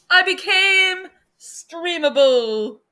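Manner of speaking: slow, high, loud mock proclamation; mock grand announcement. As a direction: declare it like big news. Stream glitch, mock announcement.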